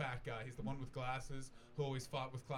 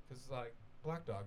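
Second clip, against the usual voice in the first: ordinary talk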